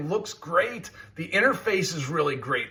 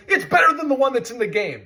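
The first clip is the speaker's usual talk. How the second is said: ordinary delivery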